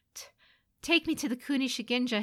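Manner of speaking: high, hurried, stumbling plea; nervous stammer. Acted. Son begging his mother for a test, stammering. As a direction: trip on the first sound, then rush it out. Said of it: "he stammered".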